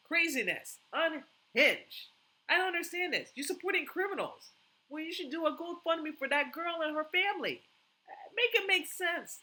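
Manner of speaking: high, loud exasperated outrage; outraged. Real, not acted. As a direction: blast the disbelief at them. Outraged at GoFundMe for criminal.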